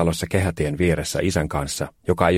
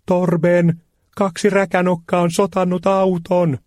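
high, loud, slow shrieking complaint; shrieking lady; 13 semitones higher, 3 dB louder, slower than usual. A narrator's reading. The lady shrieks to Torben about the car. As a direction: shriek it out to her husband, outraged. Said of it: "Hän kirkui ja osoitti".

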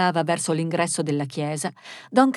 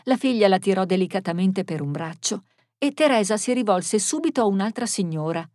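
ordinary delivery

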